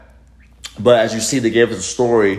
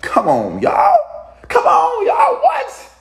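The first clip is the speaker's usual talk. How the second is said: squealed way up high and loud in disbelief; amazed shriek; 4 dB louder, slower than usual. Real, not acted. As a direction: screech it out like you can't believe it.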